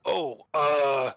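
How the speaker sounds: startled, nervous stammer; nervous NPC. Acted. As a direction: caught off guard and flustered. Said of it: "And he's like".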